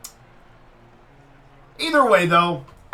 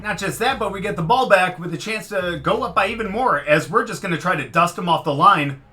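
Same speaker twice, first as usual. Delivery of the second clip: ordinary talk, nothing marked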